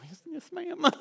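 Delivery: mock little-boy obedience; meek kid reply. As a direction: squeak it out like a scolded kid. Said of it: "And I go".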